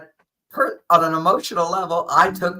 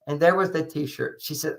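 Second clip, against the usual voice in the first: ordinary delivery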